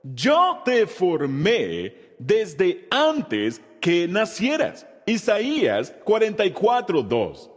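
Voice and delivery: slow, measured reciting for the class to follow; teacher reciting slowly with the class; slow group recitation. Shown as a demonstration. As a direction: lead the congregation word by word. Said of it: "Vamos a decirlo".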